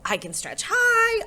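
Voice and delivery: held squeak up high on "high"; high singsong. A narrator's reading. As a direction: let "high" soar in pitch. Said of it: "thinking about high and low and start to sing".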